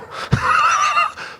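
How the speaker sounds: a sudden high squeal of laughter; short high-pitched laughing fit. Real, not acted. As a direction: burst into a squeaky giggle. Pastor laughing at congregation.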